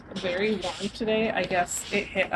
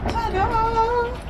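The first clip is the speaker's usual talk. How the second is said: big bright ta-da, pitch way up; excited reveal; 13 semitones higher, 4 dB louder than usual. Real, not acted. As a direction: announce the view like a magic trick. Revealing the castle view.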